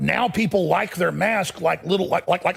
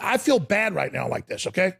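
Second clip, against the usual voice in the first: usual speaking voice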